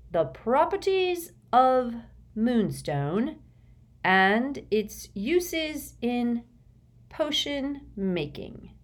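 slow, drawn-out dictation; slow writing dictation. A narrator's reading. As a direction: speak as you write it. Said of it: "he muttered".

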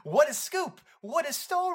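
high-pitched fake amazement; mock excitement. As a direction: gush like a tabloid reporter. Mock newsy excitement over a joke.

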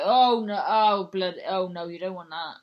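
loud, urgent imitated warning; alarmed exclamation. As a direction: blurt it out like a shocked barmaid. Barmaid warns against the shampoo.

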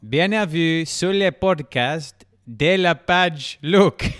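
English speaker's French, slow and high; clumsy French with an English accent; foreign accent in French. Shown as a demonstration. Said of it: "trying to speak French and doing a terrible job".

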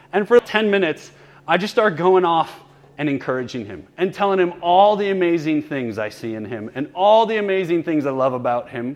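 ordinary talk

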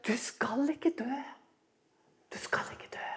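soft coaxing serpent whisper; whispered serpent lie. Acted. Preacher voicing the serpent's lie in a whisper. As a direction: whisper it like a tempting snake. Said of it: "Og så sier han".

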